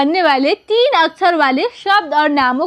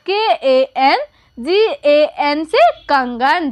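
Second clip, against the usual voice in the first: slow letter by letter; patient teacher spelling; much slower than usual. Shown as a demonstration. Teacher slowly spells a name for pupils. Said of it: "तो क्या हो जाएगा".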